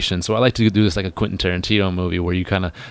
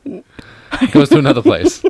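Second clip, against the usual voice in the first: high, slow, spooky drama; dramatic high slow; 10 semitones higher, 7 dB louder, slower than usual. Pretend. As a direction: stretch it out, high and eerie. Dramatic about wandering eye.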